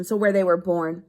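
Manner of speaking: normal talking voice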